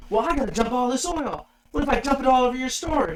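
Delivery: angry customer. Retold or imitated. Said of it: "He's like".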